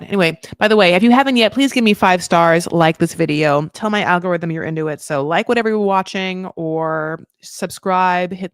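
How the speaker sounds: subscribe plea. Acted. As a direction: hype up the viewers to like the video. Loud call to subscribe.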